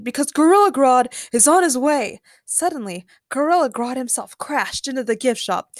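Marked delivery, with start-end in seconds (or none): 0.0-2.2 raised, loud warning; urgent shout. A narrator's reading. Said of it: "Ben shouted".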